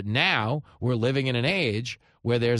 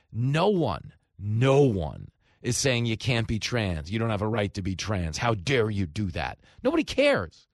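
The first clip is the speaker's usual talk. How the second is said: ordinary delivery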